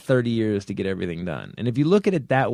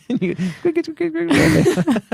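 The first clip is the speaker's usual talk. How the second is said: hurried high quick calls; 3 dB louder than usual. Pretend. Mimics quick costume change.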